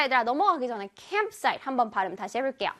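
usual speaking voice

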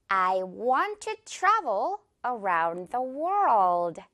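very slow, every word drawn out; slow model sentence. Shown as a demonstration. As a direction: say it slowly word by word. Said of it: "이렇게 말해볼게요". (아주 천천히 또박또박)

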